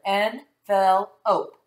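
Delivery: slowly stretched "Envelope" split into parts; teacher blends syllables slowly with the class. Shown as a demonstration. Slow sounded-out "Envelope". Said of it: "put those three syllables together".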